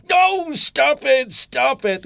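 high, slow spluttering plea. Acted. Postman begging Mister Tickle to stop, high and spluttering. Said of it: "he spluttered".

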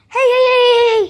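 loud, urgent hey hey to grab attention; calling out. Pretend. Child calls loudly to get someone's attention. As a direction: shout the heys across the room.

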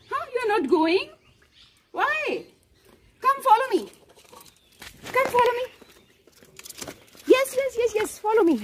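high, sing-song coaxing to the dog; high coaxing pet voice. Real, not acted. Mother coaxing her dog to follow her, high sing-song. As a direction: call him along sweetly in a high voice.

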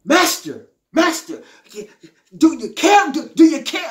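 high, pleading cry of frightened men; desperate cry to the Lord. Retold or imitated. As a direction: beg the Master to wake and help. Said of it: "they cried out".

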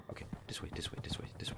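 hushed unvoiced whisper; sneaking whisper. Real, not acted. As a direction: whisper so she won't hear. Hiding, whispering directions.

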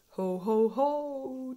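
loud hearty ho-ho-ho; jolly chuckle. A narrator's reading. Daddy Pig chuckles after mending the toy, pleased. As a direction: chuckle like a proud father. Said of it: "chuckles Daddy Pig".

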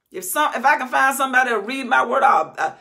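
loud high preaching; full-force preaching; preaching shout. Real, not acted. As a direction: build to a cry. Preaching climax.

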